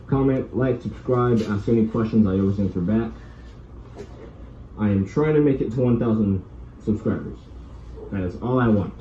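muffled behind mask; through a gas mask. Real, not acted. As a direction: voice inside rubber mask. Seen 4: Full gas mask with hose worn over the face throughout.

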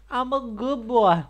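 put-on high, slow sweet good-boy voice; mock innocent. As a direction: protest innocence playfully. Repeats claim emphatically.

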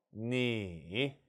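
low dipping 니 that rises at the end; third tone. Shown as a demonstration. Teacher showing learners 삼성, third-tone 니 dipping low then rising. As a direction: draw 니 down, then lift it. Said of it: "삼성".

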